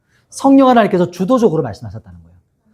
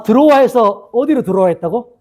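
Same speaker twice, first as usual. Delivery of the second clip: usual speaking voice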